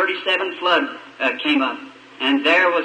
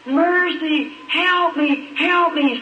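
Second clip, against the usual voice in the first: imitating a screaming mother; 10 semitones higher, much slower than usual. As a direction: mimic a desperate mother on her porch. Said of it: "just screaming".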